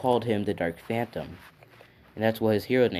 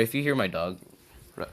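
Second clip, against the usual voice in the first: ordinary delivery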